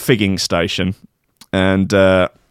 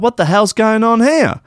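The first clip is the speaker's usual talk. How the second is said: a confused outburst at the scene; shocked; 9 semitones higher than usual. Retold or imitated. I'm like.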